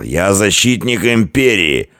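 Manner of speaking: high, drawn-out warrior's roar; drunken bellowing. A narrator's reading. Drunk barbarian bellowing slogans.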